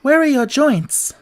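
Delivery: urgent and eager to help; girl anxiously searching the tin man. A narrator's reading. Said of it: "asked anxiously".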